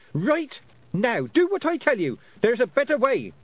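high, urgent, insistent orders; loud yelled command. A narrator's reading. Girl urging pixies to retreat, yelling. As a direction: force them to obey at full voice. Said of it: "so loudly that some of them flinched".

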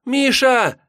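put-on high, loud girlish cry; loud call. Girl runs onto the porch after Misha, loud cry. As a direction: call him back at full pitch. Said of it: "громко закричала девчонка".

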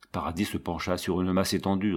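ordinary delivery, nothing marked

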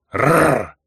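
rolling growl Рррр. Acted. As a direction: growl like an angry beast. Barbarian growls threat.

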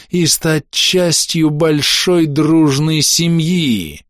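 slow, high, unnerving drawled menace; creepy mind voice. Acted. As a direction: seep into their minds, slowly. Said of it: "голос, проникал и въедался в мозг".

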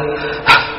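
a heavy out-breath like someone out of breath; panting exhale. Shown as a demonstration. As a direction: breathe out hard after the line. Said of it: "لما بيخلص الجملة شو بيعمل".

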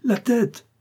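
very high cry of horror; horrified cry. Acted. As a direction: cry out in terror. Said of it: "cria-t-il".

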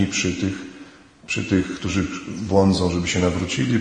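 ordinary talk

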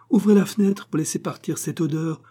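high, loud irritated order; cutting in angrily. A narrator's reading. Wife cutting off husband, irritated order. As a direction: interrupt with a sharp command.

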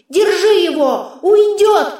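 high, urgent cry through a filter; shrieking otherworldly sidekick; sword's yell. A narrator's reading. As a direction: cry out the alarm as a disembodied sword. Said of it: "вопил меч".

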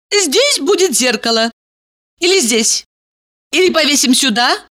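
put-on high dreamy girly voice; bubbly sorority girl. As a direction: daydream aloud brightly. Valeria dreaming aloud.

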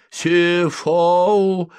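high, loud sleep-talker's cry; threatening shout. A narrator's reading. Sleeper warns off whoever disturbs his sleep, threatening shout. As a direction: cry it out to scare off intruders. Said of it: "угрожающе вскрикнул спящий".

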